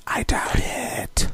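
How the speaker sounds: a dry whispered aside, slow; a slow, sceptical whisper to close the thought. Real, not acted. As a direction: breathe the doubt under your breath, slowly. Dry aside after naming next films.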